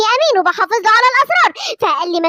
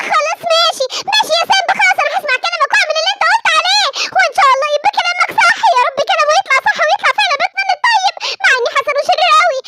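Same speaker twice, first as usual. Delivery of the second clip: higher, uneasy mother voice; worried mother; 8 semitones higher than usual. Acted. Simba's mother agreeing while still fearing Batman, higher uneasy voice. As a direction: give in but stay afraid.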